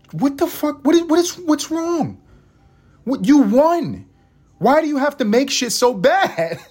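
high, incredulous squeal of disbelief; comic high-pitched exasperation; exasperated outburst. Real, not acted. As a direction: shriek it at Hollywood, fed up.

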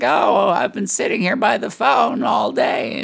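high, slow, put-upon mother wail; guilt-trip like a wounded mom; imitating mom. Son imitating his mother's guilt-trip, high and slow. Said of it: "she's like".

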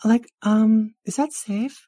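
put-on very high, girly nervous awe; high-pitched flustered onlooker; awed valley girl. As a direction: ask timidly in a high girlish voice. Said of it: "and you're like".

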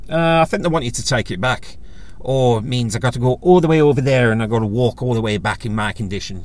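put-on old Irish lady complaining in an Irish accent. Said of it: "Apologies for the Irish accent".

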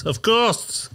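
a silly high voice for the person in the street; mock high-pitched interviewee; squeaky mock reply.

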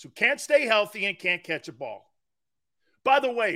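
normal talking voice

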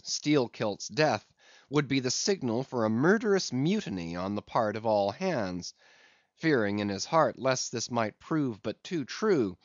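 usual speaking voice

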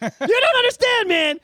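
high, loud excited plea; excited shout. Real, not acted. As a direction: insist with fan excitement. Excited fan insisting.

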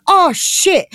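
high, startled "oh shit"; alarmed exclamation. Pretend. Kara seeing the bin lid become a serpent: high, startled alarm. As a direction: jump up in pitch at the monster. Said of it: "she's like".

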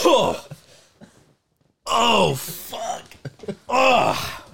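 reeling from a harsh chemical whiff; shocked by the smell. Real, not acted. Just inhaled smelling salts.

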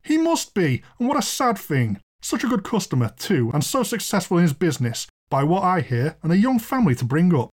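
high pitch easing down as the lament goes on; lamenting landlord. Acted. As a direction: pity a customer gone mad. Landlord laments his mad guest.

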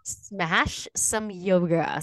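very slow, drawn-out echo of "Smash some yoga"; retold puzzled echo. Reacting to the brother's slang, slow puzzled echo. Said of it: "I'm like".

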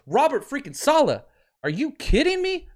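high, loud outburst of disbelief; exasperated near-scream. Real, not acted. Fan furious at the coach, high exasperated yelling. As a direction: rant at full pitch, really fed up. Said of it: "I'm gonna be screaming a lot today".

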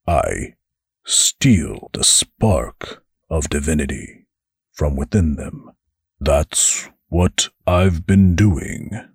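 the angel admits his secret to the demon; reluctant angel. Acted. Angel confesses.

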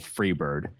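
a low, flat retold call from the crowd; mild mock yell. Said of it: "my mom at the exact same show yelled".

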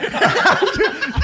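a high burst of real laughter; loud high laugh. Real, not acted. Mocking the film scene, a loud high laugh.

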